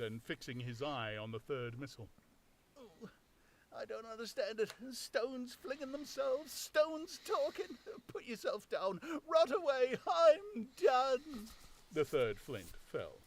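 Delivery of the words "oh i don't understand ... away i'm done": high, slow, pleading tramp voice; a rattled vagrant begging to be left alone; high, louder tramp giving up; bewildered old tramp. Acted.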